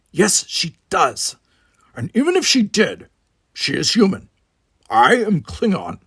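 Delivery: a proud Klingon brushing the talk aside; Worf's voice. A narrator's reading. Worf insists he is Klingon.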